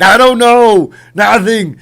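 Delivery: high, very loud retold shout; hammed-up defensive yell; loud mock yell. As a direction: holler it in comic self-mockery. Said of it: "I know I'm really loud".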